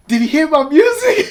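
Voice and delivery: put-on high, loud, eager girl's voice; eager young singer. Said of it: "she'd be like".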